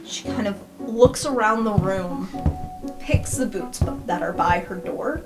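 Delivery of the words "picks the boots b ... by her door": normal talking voice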